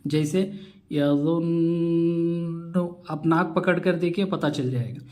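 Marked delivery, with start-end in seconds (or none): 0.9-2.8 यज़ुन्नु sung slowly, the doubled न resonating in the nose; very slow ghunna on यज़ुन्नु; nasal ghunna. Shown as a demonstration. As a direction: draw out the न of यज़ुन्नु through the nose. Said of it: "नाक में आवाज़ ले जाने को गुन्ना कहते हैं".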